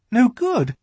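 high, alarmed question; a sudden frightened echo; startled. A narrator's reading. Lucy jumping up, startled and frightened. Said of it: "feeling rather frightened".

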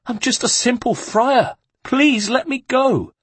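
very high, slowed, desperate plea; a broken friar begging for release; begging monk. Acted. The monk begs the guard to let him go, very high and desperate.

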